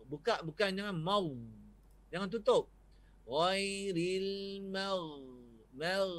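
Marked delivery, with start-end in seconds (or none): 3.2-5.2 Ghairil mau said very slowly, mau held open; open mim vowel. Shown as a demonstration. Ustaz drawing out ghairil mau as a model, very slowly. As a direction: let mau ring out with the mouth open, slowly. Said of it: "Suara lepas".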